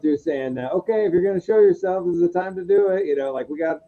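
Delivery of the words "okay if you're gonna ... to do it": retold taunt, pitched higher; provoking. Investigator goading a spirit to show itself, mildly provoking. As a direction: mildly challenge it in a raised pitch. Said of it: "I was provoking it".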